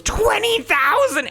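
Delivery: very high, slow, alarmed squeak; shaky nervous falsetto. Acted. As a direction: gasp it out in falsetto. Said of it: "That's my nervous voice".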